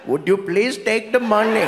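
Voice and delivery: put-on polite Indian-accented bank robber. Indian robber at a bank counter, polite Indian accent. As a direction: make a meek request in an Indian accent.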